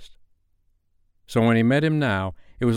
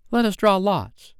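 put-on high, raised, plucky girl's voice; brave; 12 semitones higher, 5 dB louder than usual. A narrator's reading. Wendy offering to share the risk, bravely. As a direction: propose it boldly, pitched high and louder. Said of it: "Wendy said bravely".

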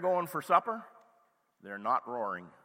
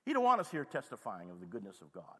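normal talking voice

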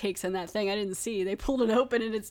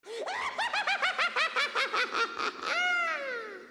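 a long, very high burst of laughter; shrieking laugh. Real, not acted. Cracking up over Benny trying not to sneeze, shrieking laugh. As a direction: howl with laughter.

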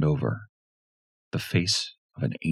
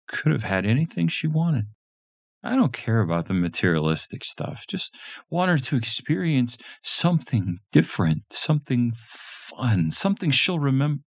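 a higher, earnest dad; earnest father voice, higher; 10 semitones higher than usual. A narrator's reading. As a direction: talk up his daughter's wish.

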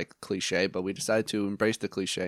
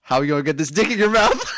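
laughing; 5 semitones higher, 7 dB louder than usual. Real, not acted. Punchline joke, laughing.

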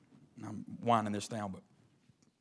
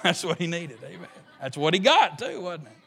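ordinary delivery

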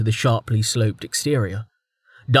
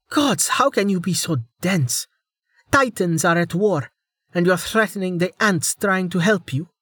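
exasperated; 8 semitones higher than usual. Acted. Heated quarrel continues.

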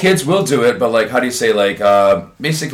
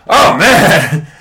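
tag; 7 semitones higher, 6 dB louder than usual. Retold or imitated. Teacher's delighted reaction in story.

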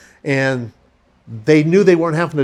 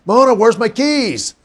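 high, slow, projected demand; wake-up call; 7 semitones higher, slower than usual. Retold or imitated. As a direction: sing out to rouse a sleeper. Repeats his wake-up call.

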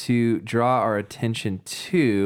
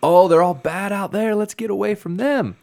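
mock alarm; 10 semitones higher, 4 dB louder than usual. Voices the misunderstanding Corinthians.

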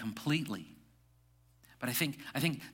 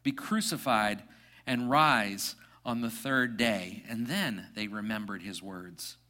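reading the passage aloud to the congregation; measured, lifted Bible reading; scripture reading; 3 semitones higher, 4 dB louder than usual. Acted. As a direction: reads the Gospel to the church.

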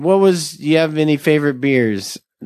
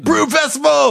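excited announcer; 11 semitones higher than usual. Pretend. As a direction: shout it like a hype announcer. Announcing the festival name.

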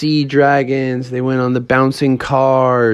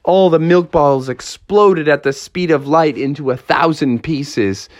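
usual speaking voice

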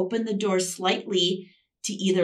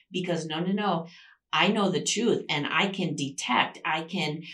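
normal talking voice

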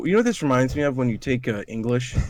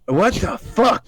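what the fuck, pitched way up and drawn out; incredulous outburst; 10 semitones higher, 3 dB louder, slower than usual. Real, not acted. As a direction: blurt it out in disbelief, high. Reacting to baffling letter text.

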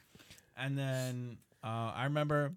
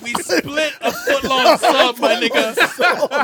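laughing; 10 semitones higher, 16 dB louder, slower than usual. Real, not acted. Laughing at splitting one sub.

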